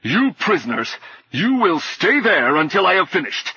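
haughty knight, irate; angry command. A narrator's reading. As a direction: order the captives to stay put. Enraged knight orders prisoners.

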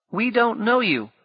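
put-on high defiant boy; high boyish refusal; boy's voice. A narrator's reading. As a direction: stand firm like a scared kid.

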